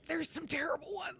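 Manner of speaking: hushed, whispered aside; comic whisper. Real, not acted. Host admits to friends some Cage movies are bad, comic whisper. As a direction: whisper it like a guilty admission.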